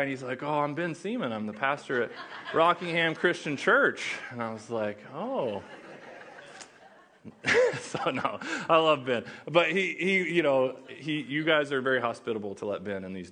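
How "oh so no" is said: ordinary talk, nothing marked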